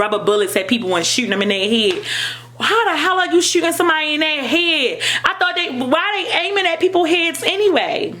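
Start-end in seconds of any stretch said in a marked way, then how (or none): 2.6-8.1 incredulous, angry questioning; real outrage. Real, not acted. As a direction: pitch jumps up high in disbelief. Outraged at police shootings.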